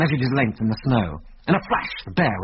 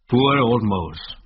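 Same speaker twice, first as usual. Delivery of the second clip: put-on old Scotsman mourning his murdered partner; a touch of grief; much slower than usual. A narrator's reading.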